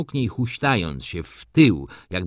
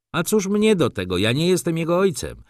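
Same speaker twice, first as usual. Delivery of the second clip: indignant retort; 8 semitones higher, 3 dB louder than usual. A narrator's reading.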